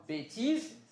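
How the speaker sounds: close-mid E in "Bêtise"; closed E. Shown as a demonstration. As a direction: say "Bêtise" with the first vowel closed. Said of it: "un et qui est fermé".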